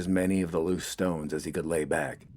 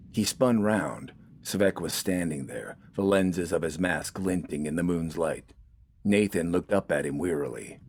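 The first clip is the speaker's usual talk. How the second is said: usual speaking voice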